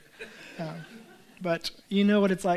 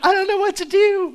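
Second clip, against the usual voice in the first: much higher, louder, with a big grin; grinning confusion; 12 semitones higher, 9 dB louder than usual. Retold or imitated. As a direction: beam while saying you're lost. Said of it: "with this huge smile on her face".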